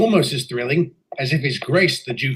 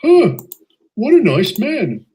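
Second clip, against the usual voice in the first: pleasant surprise; 6 semitones higher, 5 dB louder, slower than usual. Acted. Pleasantly surprised after director leaves.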